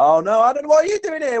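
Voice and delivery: high-pitched mock bickering. English and Scottish soldiers trading banter, mock bickering. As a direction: bicker with rising pitch, hammed up. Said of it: "proper English-Scottish banter".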